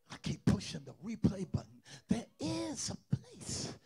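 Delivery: soft, choked-up sermon, near tears; tearful quiet preaching. Real, not acted. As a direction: pour it out gently, on the edge of crying.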